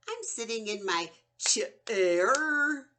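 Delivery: slow, drawn-out "I'm sitting in my chair."; mock sing-song "I'm sitting in my chair."; wrong, stretched syllables. Shown as a demonstration. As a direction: overdo every word slowly. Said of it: "I wouldn't say".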